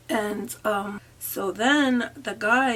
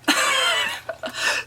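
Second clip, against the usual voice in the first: loud, very high-pitched laugh; 5 dB louder than usual. Real, not acted. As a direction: burst out in a high cackle. Amused while retelling.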